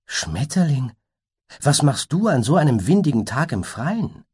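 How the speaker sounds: a worried creature asking kindly; unicorn voice. Acted.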